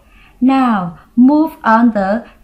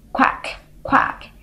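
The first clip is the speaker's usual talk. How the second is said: whispered duck quacks; duck quack imitation; 6 dB quieter than usual. Shown as a demonstration. Demonstrates duck sound.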